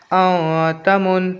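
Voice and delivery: "औ तमुन" slowly, first n held in the nose; long nasal hum on "औ", then "तमुन"; ikhfa nasal hold. Shown as a demonstration. Teacher joins the spelled syllables, ikhfa nasal hold. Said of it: "आवाज़ नाक में रोकना है".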